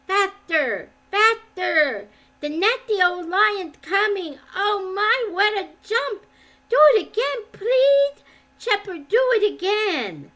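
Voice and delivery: put-on high, loud gleeful child's shouts; excited shouting. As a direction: squeal with delight on a piggyback ride. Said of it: "shouting at the top of his voice".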